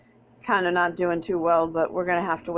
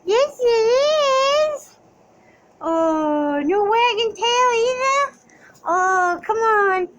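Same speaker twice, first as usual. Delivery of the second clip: high, louder sing-song coaxing; pet baby talk; 6 dB louder, much slower than usual. Acted. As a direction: coax the dog like a baby.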